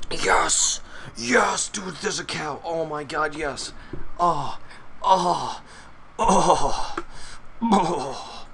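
explosive, high-pitched fanboy elation; nerding out. Real, not acted. Gamer finally back in the game, nerding out. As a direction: burst out loud, then melt into ecstatic "oh"s. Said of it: "That's me nerding everywhere".

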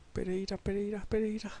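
usual speaking voice

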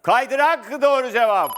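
loud, high celebratory shout; coşkulu doğru cevap anonsu. Real, not acted. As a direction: shout the winning answer to the studio. Host calls out correct answer. (coşkuyla, yüksek sesle bağırarak)

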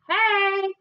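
high, drawn-out call; hollered greeting. A narrator's reading. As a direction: holler hello to someone passing. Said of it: "waved out the window and hollered".

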